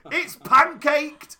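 high, loud, stretched-out scream; retold scream. Other friend seeing the flattened cat, screamed. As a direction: scream the words out slowly, full volume. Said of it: "my other friend screams".

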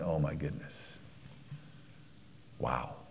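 barely audible, awed and choked up; moved whisper. Retold or imitated. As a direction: let it fall away in wonder. Overwhelmed, very soft.

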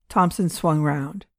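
ordinary delivery, nothing marked

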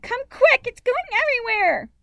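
put-on panicked, very high child's shout; loud, high-pitched frantic little girl; calling from inside. A narrator's reading. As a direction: yell for help as the pot boils over. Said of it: "Amy called from inside".

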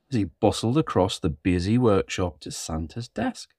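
plain reading voice

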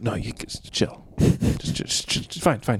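soft, half-voiced reluctant giving in; hushed, halting resignation; Jesus waving someone off. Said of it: "Jesus is like".

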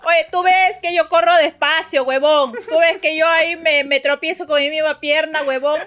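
loud, high irritated complaining; angry kid rant. Retold or imitated. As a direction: rant like an annoyed kid quitting. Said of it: "Estaba como que".

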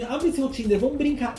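imitating a friend. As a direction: tempt her to play. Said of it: "fala assim".

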